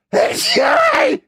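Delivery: big explosive sneeze, real. Real, not acted. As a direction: sneeze forced out by pepper up the nose. Snorted pepper, sneezes.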